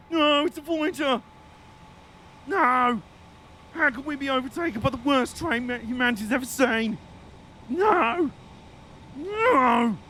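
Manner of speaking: high-pitched, hammed-up disbelief; overdramatic, high cry of protest; mock despair. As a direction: wail in mock horror at being overtaken. Mock outrage at being overtaken.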